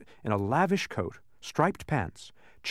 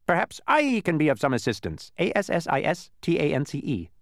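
buzzing bee voice; 7 semitones higher than usual. A narrator's reading. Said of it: "buzzed an unfamiliar voice".

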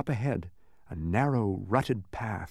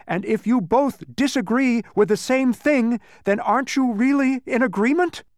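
loud, high triumphant clincher; 8 dB louder than usual. A narrator's reading. Milo clinching his logic against the mathematician, triumphant. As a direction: drive the argument excitedly to its clinching question. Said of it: "said Milo triumphantly".

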